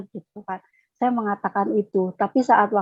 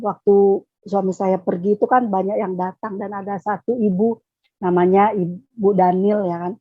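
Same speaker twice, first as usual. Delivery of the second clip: usual speaking voice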